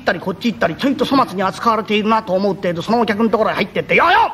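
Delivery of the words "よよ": cheering call. Acted. Jester cheering in a guest's room.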